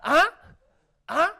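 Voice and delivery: sharp loud huh calls; loud barked huh. Retold or imitated. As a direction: punch each huh out loud. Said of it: "Chinese people are like".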